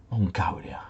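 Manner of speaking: whispered "Mongolia" in disbelief; disbelieving whisper of "Mongolia". Real, not acted. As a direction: breathe the word "Mongolia" with no voice. Repeats the answer in disbelief.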